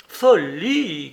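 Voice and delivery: loud, exasperated outburst; exasperated shout of Folie; exasperated cry. A narrator's reading. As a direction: snap it out, fed up. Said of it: "cria Catherine exaspérée".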